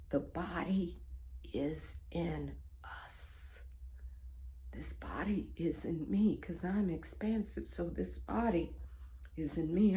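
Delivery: hushed, breath-soft realisation; reliving a revelation, very softly; awed whisper. Retold or imitated. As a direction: murmur it in stunned wonder. Whispered realisation in retold vision.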